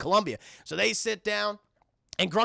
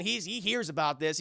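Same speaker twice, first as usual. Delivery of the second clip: ordinary delivery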